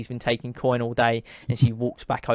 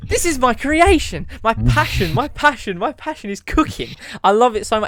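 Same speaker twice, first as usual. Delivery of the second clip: high, loud, gushing declaration; a cook proclaiming their passion at full pitch; impassioned outburst; 11 semitones higher, 8 dB louder than usual. Acted. Cook boasting to thief about her creation, impassioned and loud.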